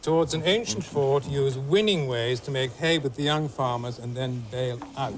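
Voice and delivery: slow clue reading; clue read again. Acted. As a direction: repeat the riddle slowly. Repeats the clue.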